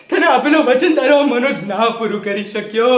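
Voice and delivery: high-pitched, anguished confession. Acted. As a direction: pour out regret to mother. Anguished confession of broken promise.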